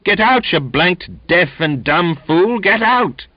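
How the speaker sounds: high, loud and slow, building rage; furious shout. A narrator's reading. Donkin screaming at the deaf Finn, furious. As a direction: bellow at the unmoving Finn.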